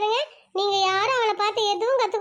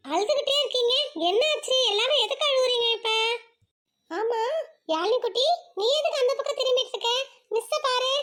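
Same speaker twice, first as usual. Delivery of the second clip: usual speaking voice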